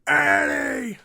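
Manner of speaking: high, loud shout of a name; yelling from the back. Retold or imitated. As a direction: call out across a crowd. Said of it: "waving his arms".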